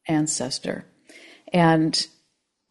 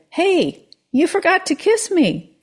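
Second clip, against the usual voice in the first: high, louder squeak of a frog; frog complaining; 12 semitones higher, 5 dB louder than usual. Acted. Frog reminding the man about the kiss. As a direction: call up from the pocket.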